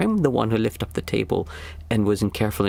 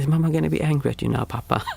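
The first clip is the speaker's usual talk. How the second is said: put-on higher, fast little girl's voice; daughter's question; 6 semitones higher, faster than usual. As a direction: ask it like a worried young daughter. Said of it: "my daughter was saying".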